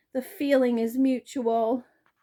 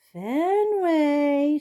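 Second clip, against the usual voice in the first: lightly voiced girl calling hopefully; 7 semitones higher, 5 dB louder than usual. A narrator's reading. Hattie calling her dog, hopeful call. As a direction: call his name up high and bright with hope. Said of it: "she calls in a hopeful voice".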